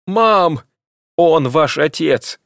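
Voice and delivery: Мам! Он ваш отец! pressed hard, high and loud, slow on ваш отец; with emphasis. A narrator's reading. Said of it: "повторила Ирина с нажимом".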